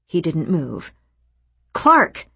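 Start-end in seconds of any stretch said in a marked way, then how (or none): 1.7-2.3 sharp raised call. A narrator's reading. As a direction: call his name to wake him. Calling him awake.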